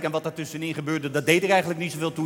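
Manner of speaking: ordinary talk, nothing marked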